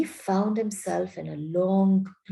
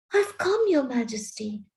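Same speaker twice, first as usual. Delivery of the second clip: eager; 3 semitones higher than usual. A narrator's reading. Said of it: "rushing eagerly forward".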